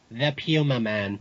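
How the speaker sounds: slow "Puma Man" with a PYOO start; film's odd pronunciation. Retold or imitated. Fan echoes the title jokingly, "Puma" said PYOO-muh.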